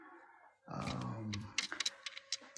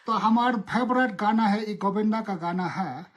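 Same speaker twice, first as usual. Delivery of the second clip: fast, higher sing-song politician's Hindi; Lalu Prasad; 3 semitones higher, much faster than usual. Acted. Politician sharing his favourite song, comic impression. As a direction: recite the song words quickly as a folksy politician. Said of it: "in Lalu Prasad accent".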